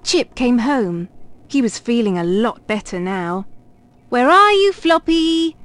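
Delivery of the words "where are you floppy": high, loud sing-out call. A narrator's reading. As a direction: call for the dog through the house. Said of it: "he called".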